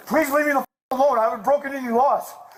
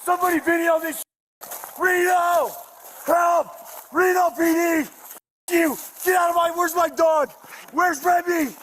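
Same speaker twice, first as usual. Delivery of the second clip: high, desperate shouting for help; frantic yelling; 5 semitones higher, slower than usual. Real, not acted. Suspect dragged to ambulance yells for help and his dog. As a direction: cry out to anyone watching, panicked.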